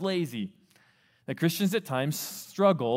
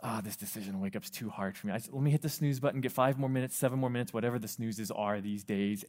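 much softer, lower, hurried mumble; groggy sleeper; 5 semitones lower, 7 dB quieter, faster than usual. Retold or imitated. Student waking to the alarm, soft and groggy. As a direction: mumble it half asleep. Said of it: "you wake up and you're like".

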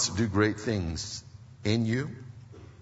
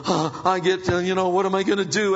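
acted freak-out, pitched up; mock panic; 9 semitones higher, 6 dB louder, faster than usual. Preacher as a fleeing believer like Elijah, mock panic. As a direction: play someone running scared, lightly hammed-up. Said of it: "you run freaking out going".

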